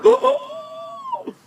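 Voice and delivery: high, loud gasping cry of shock; high-pitched breathless yelp; cold-shocked gasp. Acted. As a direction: gasp out loud as icy cold takes your breath away. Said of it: "It took his breath away".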